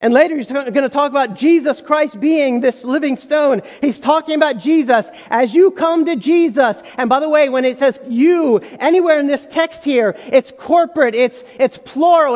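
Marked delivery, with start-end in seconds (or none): none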